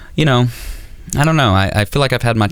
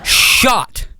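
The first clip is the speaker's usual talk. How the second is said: higher, mock-raging interrogation; imitating angry dad; 6 semitones higher than usual. Dad grilling Dan about the BB gun, mock rage. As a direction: grill him like a disbelieving father. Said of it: "And he's like".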